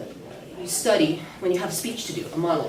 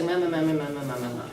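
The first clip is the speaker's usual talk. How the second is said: monotone drone; 4 semitones lower, faster than usual. Shown as a demonstration. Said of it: "So that it's not just like".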